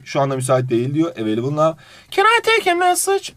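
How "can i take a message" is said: much higher put-on female receptionist voice; woman on the phone. Receptionist answering a business phone call, high-pitched female voice. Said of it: "Kadına cevap veriyor".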